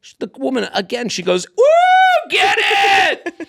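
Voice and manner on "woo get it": high, loud mock holler; whooping cheer. Helped woman heckling the second kiss, whooping cheer. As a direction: imitate a rowdy heckler whooping.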